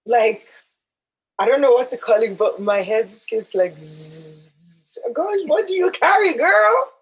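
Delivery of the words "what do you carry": ordinary talk, nothing marked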